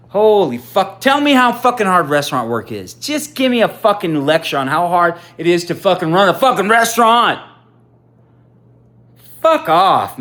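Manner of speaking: loud, high fed-up tirade; furious rant. Real, not acted. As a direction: let it all out, exasperated. Ranting at restaurant-work lecturers.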